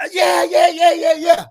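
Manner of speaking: overjoyed vet hollering yes down the phone; excited yelling. Retold or imitated. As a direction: shout it out like you just won. Vet excitedly calling back.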